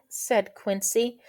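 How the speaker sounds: plain reading voice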